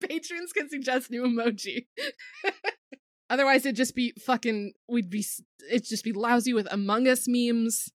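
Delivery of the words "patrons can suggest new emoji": laughing. Real, not acted. Cracking up while finishing the pitch.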